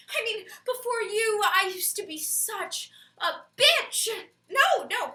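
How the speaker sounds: loud, high theatrical alarm; comic panic. Acted. Partner begging someone not to open the box, comic panic. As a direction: frantically stop them, hammering the stressed words.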